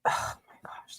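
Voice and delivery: breathy, whispered disbelief; shocked whisper. Real, not acted. Reacting to her threat to his room, shocked whisper. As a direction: whisper it under your breath, appalled.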